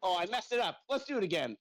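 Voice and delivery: usual speaking voice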